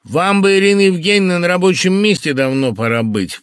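loud, forceful rasping rebuke. A narrator's reading. Phil ordering Irina off to work, rasping. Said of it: "прохрипел Фил".

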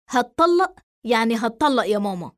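ordinary delivery, nothing marked

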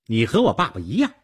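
loud, quick accusation; angry. A narrator's reading. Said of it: "女孩气愤地说". (气愤地说)

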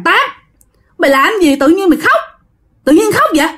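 a neighbor half-yelling at a crying woman; scolding neighbor. Retold or imitated. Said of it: "mới la dì Tám".

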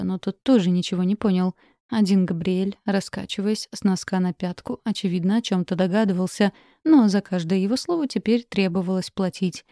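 usual speaking voice

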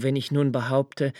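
ordinary talk

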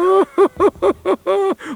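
a high, mocking cackle; mocking laugh. Djinn laughing at the rooted prince, high mocking cackle. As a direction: cackle down at the stuck little tree.

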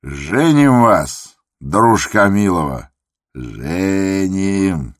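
loud, long-drawn playful crowing; sly teasing. A narrator's reading. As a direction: tease a friend, stretching each vowel. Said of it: "лукаво подмигнул глазом".

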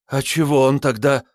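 high, close to crying; near tears babble. A narrator's reading. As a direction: whimper it out, scared. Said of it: "пролепетал, чуть не плача". (лепет сквозь слёзы)

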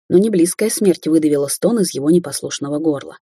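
usual speaking voice

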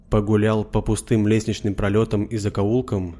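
usual speaking voice

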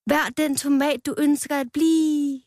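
Rød shouting as he jumps into the salad bowl; shouted. A narrator's reading. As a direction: call it out boldly as a motto. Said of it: "råbte han".

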